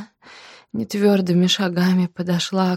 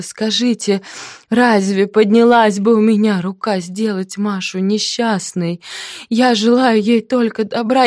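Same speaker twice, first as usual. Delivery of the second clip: loud, higher, desperate appeal; nervous pleading; 5 semitones higher, 7 dB louder than usual. Acted. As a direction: beg them to believe you. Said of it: "Карл Палыч нервно кивнул".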